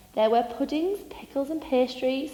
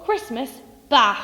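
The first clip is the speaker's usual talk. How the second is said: grumpy shout back; 2 semitones higher, 7 dB louder than usual. Acted. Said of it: "he shouted back".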